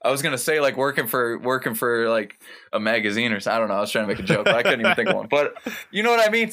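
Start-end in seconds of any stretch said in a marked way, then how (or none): 5.3-6.5 laughing. Real, not acted. Laughing at his failed joke.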